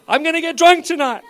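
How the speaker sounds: high, loud gleeful announcement; exuberant declaration. Acted. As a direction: shout it like an eager reveller. Quotes an eager guy.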